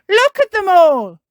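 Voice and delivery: put-on high, loud child's shout; excited shout. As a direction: cry out in amazement at full voice. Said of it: "she shouts".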